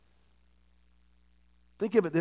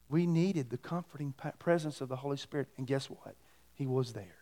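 soft, hushed, sincere; tender testimony; 3 semitones lower, 10 dB quieter than usual. Real, not acted. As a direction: share it gently, almost softly.

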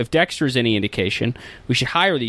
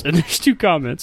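usual speaking voice

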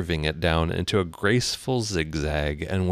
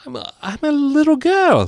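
put-on high, hesitant little-girl voice; high-pitched, uncertain claim; doubtful; 7 dB louder than usual. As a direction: stumble into an unsure answer. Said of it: "rather doubtfully".

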